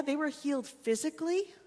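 ordinary delivery

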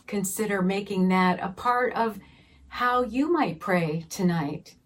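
usual speaking voice

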